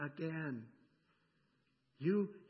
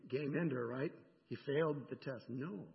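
ordinary talk

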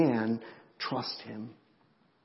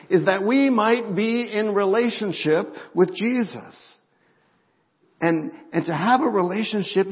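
louder, higher, driving sermon climax; fervent pulpit preaching; preaching at full force; 6 semitones higher, 7 dB louder than usual. Acted. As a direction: preach it out to the whole room.

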